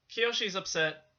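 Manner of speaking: normal talking voice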